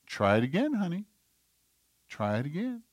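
soft, patient parent voice; gentle encouragement. Acted. Parent to a toddler stacking blocks, gently encouraging. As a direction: coax a small child softly, lifting at the end.

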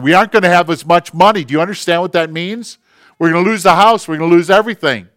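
fast, loud, high-pitched tirade; angry shouting. Retold or imitated. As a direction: shout it vehemently at your wife. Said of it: "the vehemence of his voice shouting at her".

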